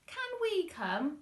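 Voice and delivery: put-on high, slow child's plea; small boy pleading. Lester asking his mum, high hopeful child. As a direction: ask like a hopeful little boy.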